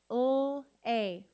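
L. A. as held loud lll, then long ay; sounded out. Shown as a demonstration. Teacher sounding out a syllable, L. A. as two sounds. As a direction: separate the sounds of L. A. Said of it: "Let's sound out each syllable".

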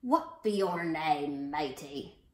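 low, slow, loud pirate voice; hammed-up pirate questioning a newcomer; head pirate. A narrator's reading. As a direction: demand it like a pirate captain. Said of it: "the head pirate asked".

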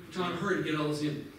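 ordinary delivery

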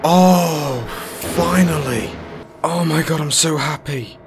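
high, loud ecstatic oh oh oh; finally through the maze, overjoyed; joyous cry. Real, not acted. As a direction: cry out in relief and delight.

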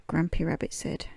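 ordinary delivery

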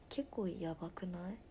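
whisper. Real, not acted. Whispered aside while reading chat.

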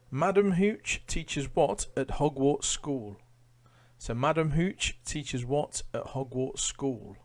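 slow, clear quizmaster read-out; quiz question read. Acted. Quizmaster reading a question to online quiz players, slow and clear. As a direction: lay out the question slowly for the players.

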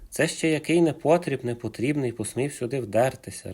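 plain reading voice

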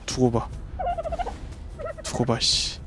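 seethe under your breath; quiet sulky revenge vow; muttered threat. Real, not acted. Killed by raptor, grumbling.

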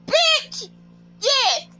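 high-pitched, emphatic outburst; impassioned high-voiced reaction; worked-up agreement. Own worked-up reaction, retold.

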